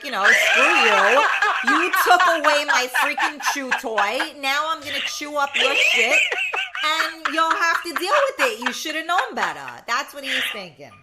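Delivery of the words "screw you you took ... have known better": put-on loud, laughing cranky old dog; mock-angry dog yelling through laughter. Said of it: "so now he's like".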